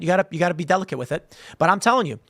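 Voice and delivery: ordinary delivery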